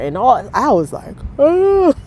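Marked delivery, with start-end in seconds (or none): 1.4-1.9 sharp, higher, louder startled oh; a caught-off-guard little gasp of a word; stunned oh. Retold or imitated. Girl reacts startled to her mother's sudden scolding.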